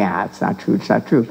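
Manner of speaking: low retold brush-off to oneself; dismissive self-talk. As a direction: lightly dismiss it, pitched well down. Acted dismissive thought to herself.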